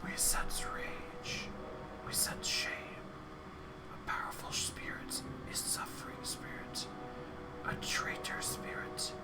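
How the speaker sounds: soft whispering chorus of skulls. Acted. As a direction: breathe every word as a whisper. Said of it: "their whispers blending together in a chorus".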